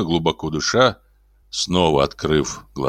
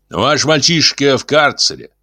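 cold stern; 7 semitones higher, 4 dB louder than usual. A narrator's reading. Simon cold stern report.

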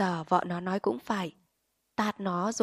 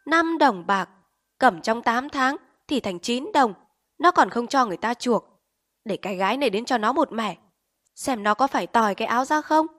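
higher, louder furious rant; angry scolding; 4 semitones higher, 6 dB louder than usual. A narrator's reading. Angry mother orders daughter and rants about the pawnbroker, fuming. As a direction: rail at the greedy pawnbrokers. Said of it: "Rồi tức bực".

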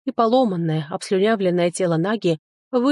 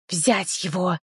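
snake queen's sharp order; commanding snake; slower than usual. A narrator's reading. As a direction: order the squirrels to attack. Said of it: "скомандовала Нага".

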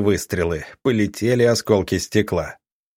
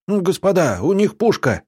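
much higher, frantic yell; alarmed shout; 9 semitones higher than usual. A narrator's reading. Militiaman shouts a warning about the gun, high and urgent. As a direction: sound the alarm about the cannon. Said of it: "вдруг закричал".

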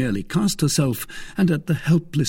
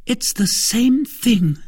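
slow, high, louder retort; vehement cry; 6 dB louder, slower than usual. A narrator's reading. Wife equating Cardinal with devil, vehement. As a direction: hammer it out vehemently. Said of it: "cried the young woman".

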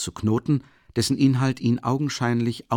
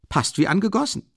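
delighted; 10 semitones higher than usual. A narrator's reading. Friend admires the fit.

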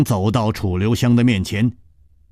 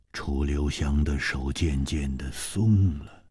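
usual speaking voice

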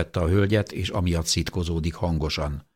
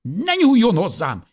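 angry shout; 14 semitones higher, 6 dB louder than usual. Acted. Old woman fighting off nurse.

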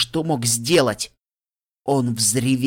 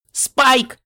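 high, loud cry of «Спайк»; desperate shout; 12 semitones higher, 7 dB louder than usual. A narrator's reading. Littlepip frantically calling the dragon Spike, a loud high shout. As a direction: yell his name in panic. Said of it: "закричала я".

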